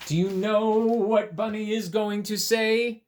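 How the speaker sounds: ordinary delivery, nothing marked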